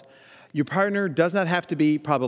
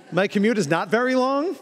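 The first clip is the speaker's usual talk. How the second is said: normal talking voice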